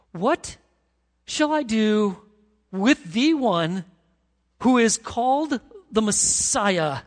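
slow, emphatic Pilate; pressing question. Retold or imitated.

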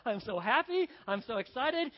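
loud, giddy mock excitement; pumped up. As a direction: burst out, bubbling over. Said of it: "he's pretty pumped up".

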